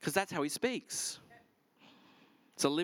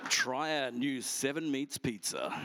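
smooth radio ad read; Domino's ad read; slower than usual. Shown as a demonstration. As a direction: sell the pizza like an on-hold announcer. His Domino's ad voice shown.

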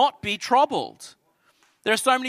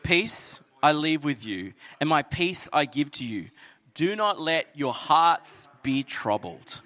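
tender rereading; 4 semitones lower, slower than usual. Acted. Pastor repeating the promise to the church, tender. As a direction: savour the promise gently. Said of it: "What a beautiful promise".

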